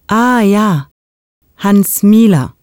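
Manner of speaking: usual speaking voice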